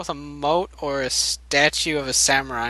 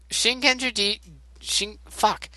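put-on Norwegian accent; 6 semitones higher than usual. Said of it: "He went to, like, a Norwegian accent".

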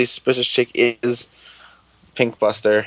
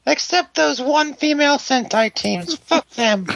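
disgruntled Tiny Tim. Retold or imitated. Mocking fans in a Tiny Tim whine, very high. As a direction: chirp it like a bitter Dickens child. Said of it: "disgruntled Tiny Tim".

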